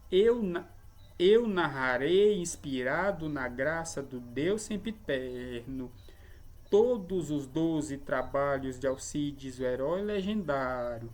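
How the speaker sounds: slow verse scansion, stretching each long syllable; leitura métrica hexâmetra. Shown as a demonstration. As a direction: recite in six stressed feet, long then two short. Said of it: "É assim que se deve ler". (declamação lenta e ritmada em hexâmetros)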